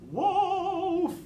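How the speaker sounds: high, loud, drawn-out call; cried false alarm. A narrator's reading. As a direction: sing out a long cry of alarm. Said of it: "he got bored and cried".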